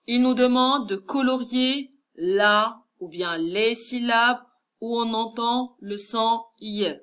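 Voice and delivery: usual speaking voice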